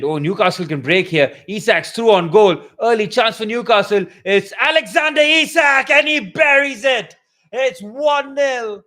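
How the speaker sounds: rising to a loud, high shout as he scores; excited goal call. Real, not acted. Fan commentator calling Newcastle's opening goal, excited shout.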